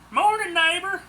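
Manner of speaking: high, loud, cheery call; bright greeting. Acted. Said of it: "Claude said brightly".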